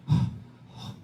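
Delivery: quick breathless huffs; panting breaths. Acted. Moses fleeing into the desert, panting. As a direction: pant like someone fleeing.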